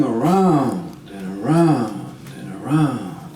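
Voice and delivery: slow, swooping "around", trailing away; going endlessly in circles; drawn-out circling. Acted. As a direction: stretch each "around" in a looping wave. Storyteller mimics endless circling in forest.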